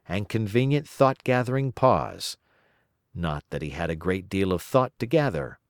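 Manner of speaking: plain reading voice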